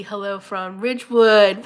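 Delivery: usual speaking voice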